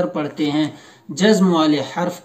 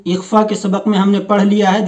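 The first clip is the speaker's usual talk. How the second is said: ordinary talk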